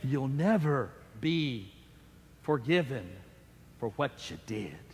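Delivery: Satan speaking to Paul, low sinister whisper; demonic voice. Acted. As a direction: let it sink into a menacing hush. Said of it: "as a demonic voice, a voice of Satan".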